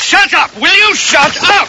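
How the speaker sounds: high angry yell; angry shout. Acted. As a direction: bark it out at him. King shouting down peasant.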